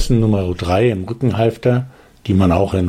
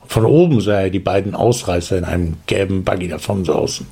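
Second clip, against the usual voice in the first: plain reading voice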